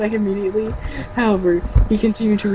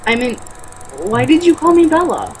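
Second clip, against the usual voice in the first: persisting; 4 semitones higher than usual. A narrator's reading. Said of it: "I persisted stupidly".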